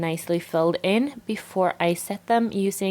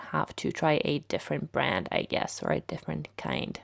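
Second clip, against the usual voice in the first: hoarse, fading raspy voice; words scraping out of a raspy throat; 4 semitones lower, 7 dB quieter than usual. Real, not acted. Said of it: "I'm sorry about my raspy voice".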